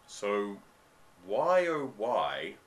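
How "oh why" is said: normal talking voice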